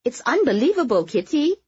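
high, loud gushing party guest; lifted, effusive compliment; gushing praise. A narrator's reading. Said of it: "Pan Ting Ting praised".

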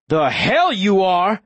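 slow, loud defiant yell; angry yell. A narrator's reading. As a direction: spit it out in fury. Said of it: "I yell".

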